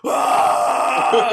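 loud, high retold roar of effort; jar-opening war cry, imitated; mock roar. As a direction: belt out a hammed-up strongman yell. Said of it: "just goes".

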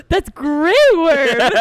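loud, slow, awestruck recognition; starstruck gasp. Retold or imitated. Kumail spotting Grey Worm, loud starstruck awe. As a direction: breathe it out like meeting your hero. Retold fan recognizing actor.

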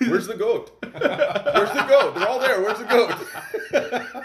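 high, loud, slow mocking call; hammed-up high-pitched taunt. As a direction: squeal it like a silly onlooker. Mocking distant watcher's voice.

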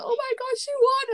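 high-pitched fan squeal of words, retold; excited outburst. Fan retelling their reaction to a favourite's win, excited. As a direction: re-enact being overjoyed, pitch leaping right up.

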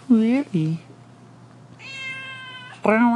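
very high sing-song voice for the cats, with meows; high pet talk. Acted. Greeting the cats, squeaky high baby talk.

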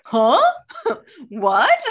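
loud, high-rising mock disbelief; exaggerated retold bafflement; baffled surprise. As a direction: replay a startled double take, pitch shooting up. Reenacting surprise at friend's cheerleading claim.

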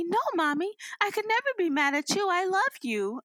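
put-on high, reassuring child voice; high-pitched imitation of a forgiving child; sweetest little girl. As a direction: comfort mommy like a sweet small girl. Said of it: "had the sweetest girl".

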